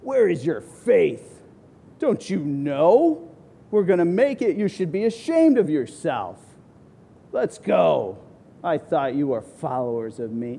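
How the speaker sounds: lightly voiced stern rebuke, looking down on them; condescending.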